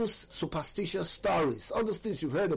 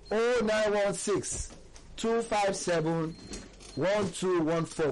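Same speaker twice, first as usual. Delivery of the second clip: slow, higher-pitched number read-out in separate groups; slow dictation; 3 semitones higher, slower than usual. Real, not acted. Dictating second number to listeners.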